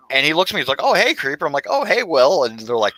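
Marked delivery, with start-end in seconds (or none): 0.8-1.5 mock-surprised friendly hello, pitched up; imitating a cop spotting an old friend; bright raised mock hello; surprised greeting. 1.7-2.4 higher, louder retold hello of recognition; raised mock-cheerful hello; surprised greeting.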